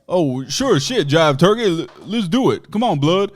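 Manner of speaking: high, loud jive-talking caricature voice. Retold or imitated. Older kid answers the skaters, hammed-up loud caricature.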